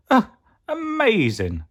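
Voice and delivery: high, loud, stretched amazement; amazed Gruffalo. A narrator's reading. Gruffalo marvels at the mouse, in loud astonishment. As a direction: gasp it out in wonder, swooping down.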